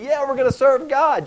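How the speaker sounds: loud, high-pitched crowd enthusiasm; fired up. Acted. People of Israel pledging to Joshua, acted fired-up excitement. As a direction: cheer it out like an eager mob. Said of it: "get all fired up and say".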